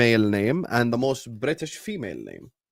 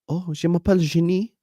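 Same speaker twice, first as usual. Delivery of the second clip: higher-pitched French woman introducing herself; 5 semitones higher than usual. Acted. Imagined French woman saying her name is Jenny, French accent. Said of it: "you could meet a French woman that's called Jenny".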